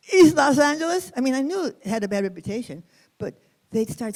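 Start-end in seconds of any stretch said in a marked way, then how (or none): 0.1-1.1 higher and louder, alarmed disbelief; shocked question. Retold or imitated. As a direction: react as if it's dangerous. Partygoers shocked at her neighbourhood.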